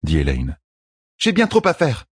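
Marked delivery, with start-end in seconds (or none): 1.2-2.1 higher, lightly voiced queen, indignant; protesting queen. A narrator's reading. Queen protests to midwife, lightly indignant. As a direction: push back against the order.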